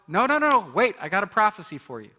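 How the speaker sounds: high, loud urgent shout. Retold or imitated. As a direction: stop them in their tracks. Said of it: "he shouts".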